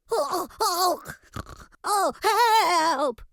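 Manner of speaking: high, panting cries for help; shivering with fear. A narrator's reading. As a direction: shiver out the words in panic. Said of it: "shivered Horace".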